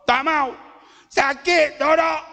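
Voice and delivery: put-on a sore child refusing to be fed; high, hurting protest, acted out.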